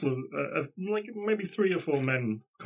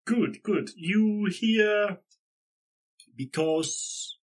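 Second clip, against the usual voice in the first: high, slow broken-English greeting; halting foreign villager; 5 semitones higher, 4 dB louder, much slower than usual. Acted. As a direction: feel out each word like a villager with little English. Plays local man with little English.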